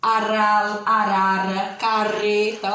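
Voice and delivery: Aral, arara, carreta with a rolled tongue R; trilled R. Shown as a demonstration. Teacher demonstrating the R for students, tongue trill. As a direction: tremble the tongue on each R. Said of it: "faz aquela tremedinha na língua pra falar".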